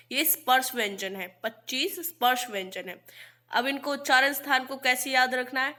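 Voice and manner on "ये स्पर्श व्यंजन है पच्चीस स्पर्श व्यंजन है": ordinary talk, nothing marked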